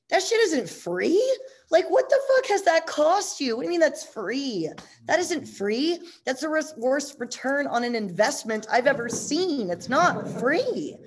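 high, loud, furious scolding; angry lecture. Retold or imitated. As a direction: tear into her for calling it free. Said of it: "he got really mad".

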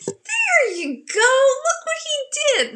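very high, loud squeal of delight; acted-out jubilant exclamation; exultant. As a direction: shout it high, bursting with glee. Acted joyful reaction to providence.

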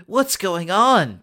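high, loud, slow cry; alarmed cry. Acted. Jordan cries out in alarm at still being there. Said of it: "Jordan cries".

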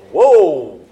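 loud, mock-shocked whoa. Crowd reacting to Jesus forgiving sins, loud mock shock. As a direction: blurt it out like an appalled crowd.

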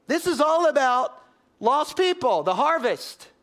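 loud, high, driving sermon climax; full-force preaching. Real, not acted. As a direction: preach it hard and loud to the church.

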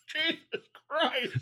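laughing in disgust at a taste. Real, not acted. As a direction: groan it out while laughing. Tasting a disgusting jelly bean.

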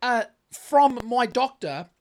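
slow, deliberate explanation at the counter; slow, pointed retelling of his reply to the cashier; slow retold reply. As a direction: spell it out slowly to the cashier. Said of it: "So I said to her".

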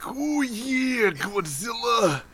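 high, drawn-out wrestler impression; Macho Man. Imagining Ghidorah taunting Godzilla, Macho Man impression. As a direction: stretch it out like a hammed-up wrestling catchphrase. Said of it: "like, the Macho Man voice".

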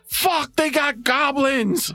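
startled dwarf. Acted. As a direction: gasp it out in dismay.

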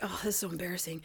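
whispered and mortified; hushed mock embarrassment, unvoiced; embarrassed whisper. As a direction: breathe it out like a teen ashamed of his parents. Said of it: "He was like".

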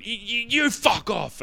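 high, slow, stammering mock retort; lame stammered comeback. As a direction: fumble the comeback like a flustered teenager. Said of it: "I went".

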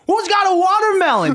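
high-pitched quoted movie line; Barry. Retold or imitated. Quoting funny line said while dancing, high imitation. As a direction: call it out high, as if into the distance. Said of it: "looks off into the distance and he says".

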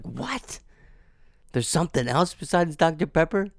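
put-on high, startled kid voice; kid's surprise. Recalling childhood discovery, high surprised kid voice. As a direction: gasp it like a shocked child. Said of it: "I was like".

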